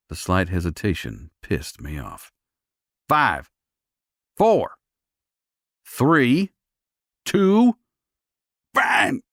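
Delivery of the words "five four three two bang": rising high countdown; countdown threat. A narrator's reading. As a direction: count down through clenched teeth. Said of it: "hissed between clenched teeth".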